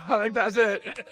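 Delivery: slow, laugh-filled wrap-up; laughing slowly. Real, not acted. Cohost wraps up the game list, laughing slowly. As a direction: chuckle through it, drawn out.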